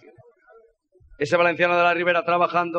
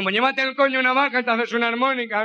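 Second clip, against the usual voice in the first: higher nasal mocking retort; slaughterman punchline; 7 semitones higher than usual. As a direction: deliver the punchline with a laugh.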